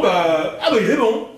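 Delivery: high, loud mock tippler's verdict; unrefined wine drinker. Drinker who can't talk wine asks for another glass, mock simple and loud. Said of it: "ceux qui savent pas en parler".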